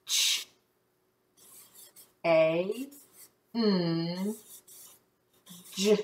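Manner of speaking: "Ch-, A, N, G" softly, with long gaps; isolated phonics sounds. Shown as a demonstration. Checking word sound by sound.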